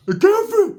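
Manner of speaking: high squeaked line; squeaky punchline. Acted. Comedian squeaking the punchline to the crowd. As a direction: pop it up high for the joke. Comic exclamation about toilet.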